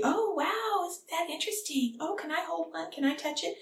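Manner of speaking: high, soft imitation of wowed little kids; excited preschoolers. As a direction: mimic small children eager to touch it. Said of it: "And they're all gonna be".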